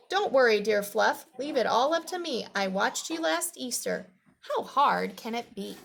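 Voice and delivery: put-on lively, projected read-aloud for children; storybook reading. A narrator's reading. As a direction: read it out clearly to young listeners.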